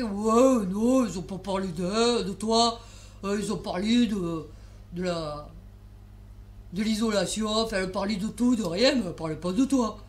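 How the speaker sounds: lightly voiced colleague, a touch different from her own voice; a hint of Dinora confirming nobody talked about Anne; quoting Dinora. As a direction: play the calm colleague backing her up. Voicing colleague Dinora's reply.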